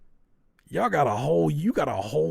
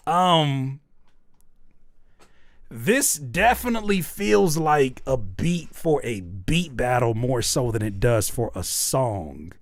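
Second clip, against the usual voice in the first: normal talking voice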